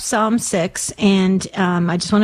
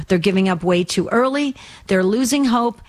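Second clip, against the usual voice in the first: ordinary talk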